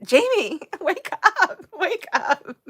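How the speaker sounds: giggling wake up Jamie call; teasing wake-up call. Retold or imitated. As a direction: playfully nudge a dozing friend. Teasing tired friend Jamie.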